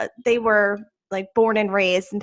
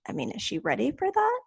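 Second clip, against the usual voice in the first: higher, softer doubtful husband; imitating husband; 10 semitones higher, 7 dB quieter than usual. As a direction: quote a husband's hesitant question, pitched up. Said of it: "And he's like".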